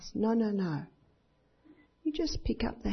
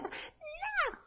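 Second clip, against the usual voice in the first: very high, thin mock wail; screaming child; 10 dB quieter than usual. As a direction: imitate a child squealing a refusal. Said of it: "when you stop screaming".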